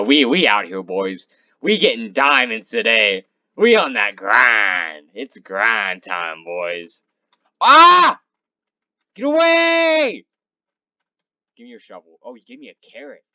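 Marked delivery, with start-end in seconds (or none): 0.0-7.0 hyped bro talk. Real, not acted. Hyping up the boys. 7.6-10.2 loud, high scream to drive it off; startled scream. Real, not acted. Monster attacks.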